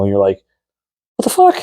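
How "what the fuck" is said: very high-pitched, baffled mock reaction; squeaky disbelief. Hunter voicing a first-timer's reaction to a shot, high squeaky disbelief. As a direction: squeak it out in comic bewilderment.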